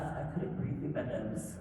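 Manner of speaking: normal talking voice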